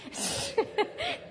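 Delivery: bright high laugh at his own stumble; short laugh. Real, not acted. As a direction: laugh it off lightly. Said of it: "laughs at own fumble".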